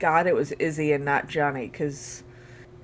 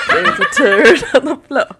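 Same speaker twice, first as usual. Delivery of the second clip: louder and higher, cracking up mid-line; laughing; 9 semitones higher, 8 dB louder than usual. Real, not acted. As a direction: burst out laughing through the words. Gleefully repeating funny line.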